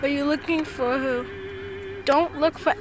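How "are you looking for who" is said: ordinary talk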